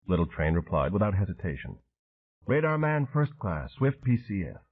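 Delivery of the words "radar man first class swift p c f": lightly voiced old Cajun boatman, a bit higher; gruff Cajun veteran. Train answering Dan in his Cajun voice. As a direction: Swift P C F spoken in Train's clipped drawl.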